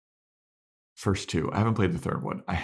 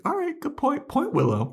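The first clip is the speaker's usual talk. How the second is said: much higher, joking agreement; mock high concession. Podcaster mock-agreeing with Willow, high and joking. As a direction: squeak a sarcastic point to Willow.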